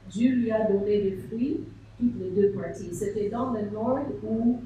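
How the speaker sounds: ordinary talk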